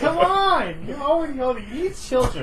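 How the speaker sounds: high, laughing nagging auntie; matchmaker auntie. Pretend. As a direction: whine at her to have kids. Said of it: "nagging relative voice, matchmaker auntie".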